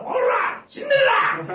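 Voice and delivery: high, loud thug yell; mock yell. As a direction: bark it like a former delinquent. Yelling at Cinderella on parade.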